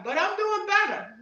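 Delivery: normal talking voice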